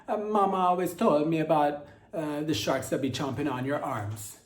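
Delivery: bitten Italian diver. Acted. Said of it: "And he's like".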